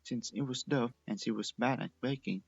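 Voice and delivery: plain reading voice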